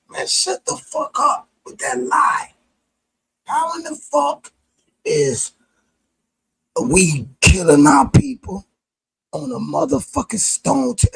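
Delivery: high, strained angry protest; outraged outcry. Real, not acted. Defending his ancestors against historians, outraged outcry. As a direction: cry out against the lie.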